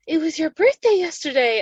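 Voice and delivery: chirpy dentist, a bit surprised; imitating the dentist. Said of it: "she was like".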